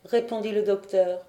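plain reading voice

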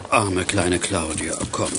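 soft, slow and tender; comforting. Acted. A kind man comforting a frightened girl, tender. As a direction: soothe her gently.

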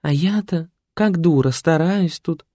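a fussy caretaker feeling foolish; lady program voice. Acted. Caring program complains.

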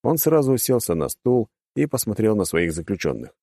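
plain reading voice

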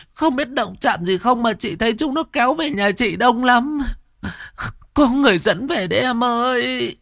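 very high tearful wail; sobbing. A narrator's reading. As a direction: pour it out through sobs, high-pitched. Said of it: "khóc rưng rức".

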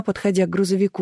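ordinary delivery

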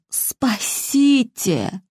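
very slow, strained wheeze; hoarse cry for help. Acted. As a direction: drag the word out, choking. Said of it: "прохрипела Розалия".

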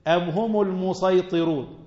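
slow recited verse; Quran recitation. Acted. Teacher reciting a verse to students, slow chant.

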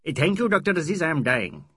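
joking he is starving to death; mock-dying friend. Mahmud Ali jokes about hunger.

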